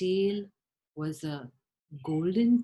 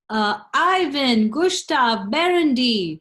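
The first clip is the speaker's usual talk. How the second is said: summoning king; 3 semitones higher, 8 dB louder than usual. Acted. King summons three sons.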